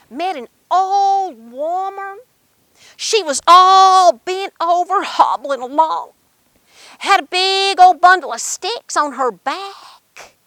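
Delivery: slow, drawn-out story narration; slow storytelling. Acted. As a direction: paint the old woman slowly. Narration slows describing the old woman.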